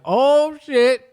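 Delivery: loud, high outburst; imagine bursting out at the wrong card; blurted shock. Retold or imitated. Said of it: "I would have vocally just, just bursted out".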